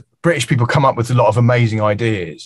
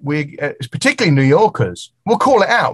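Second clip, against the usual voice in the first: usual speaking voice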